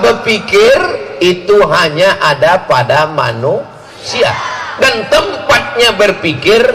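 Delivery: high, ringing sermon build-up; fiery preaching. Acted. Preacher rouses parents with a rising, ringing sermon. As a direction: preach it up to the punchline.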